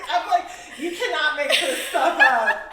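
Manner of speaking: laughing loudly while talking; words bursting through laughter. Real, not acted. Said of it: "This is so hilarious".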